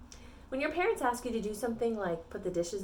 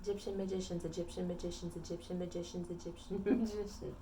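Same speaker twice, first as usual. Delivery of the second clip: rapid-fire repeats under the breath, much softer; tongue twister fast; 2 semitones lower, 9 dB quieter than usual. Shown as a demonstration. Teacher trying a tongue twister five times, quick soft mumbling. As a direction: rattle it off quietly until it collapses in a laugh. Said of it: "Can you say that five times fast?".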